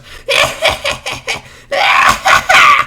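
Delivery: very high, loud mocking cackle; hammed-up shrieking laugh of a gleeful brat; imitated devilish kid laugh. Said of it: "That was definitely what he was laughing like".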